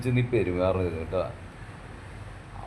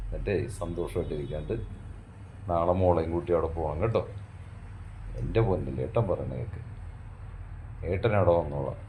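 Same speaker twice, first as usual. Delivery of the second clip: much lower, much softer, tender; soothing comfort; 3 semitones lower, 6 dB quieter than usual. Acted. Husband comforting his crying wife with soft promises, soothing. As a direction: hush her tears lovingly.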